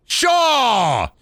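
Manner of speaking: high, loud call of the name; shouted name. Real, not acted. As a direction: belt out the name across the map. Yelling a character's name in game.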